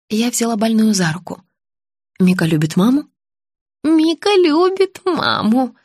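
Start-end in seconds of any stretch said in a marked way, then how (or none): 3.8-5.8 put-on high, drawn-out childlike assurance; confused old woman. A narrator's reading. Patient assuring her visitor, confused old woman's high voice. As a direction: assure slowly in a high little voice. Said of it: "заверила Наталья".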